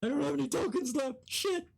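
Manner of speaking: high, fast squeaky player voice. Acted. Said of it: "insert coin to continue".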